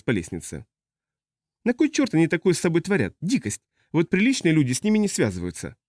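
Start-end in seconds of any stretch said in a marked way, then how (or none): 1.6-5.8 high-pitched prissy disapproval; indignant customs officer. Acted. Customs officer condemns body modification, high and indignant.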